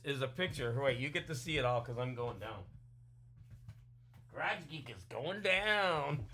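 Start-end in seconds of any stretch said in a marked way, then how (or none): 4.3-6.2 mock threat. Teasing a viewer on screen.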